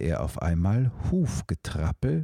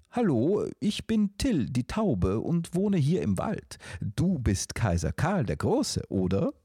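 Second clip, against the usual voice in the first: high, bright bird voice lifted well up; small talking dove greeting the emperor; chirpy dove; 7 semitones higher than usual. Acted. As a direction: chirp it like a cheerful little dove. Dove Till introduces himself.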